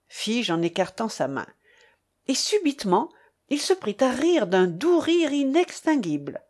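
ordinary delivery, nothing marked

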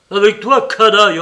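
crié haut et fort, plein de mépris; indignation furieuse. Acted. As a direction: jette l'insulte avec fureur. Léonie outragé par son valet. (s'indigne en criant contre le valet)

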